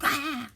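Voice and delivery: short high yelped "Ah!", acted out; mock yell. Retelling how she yells when Joe scares her, mock yell. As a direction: mimic your own startled yell. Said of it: "I yell".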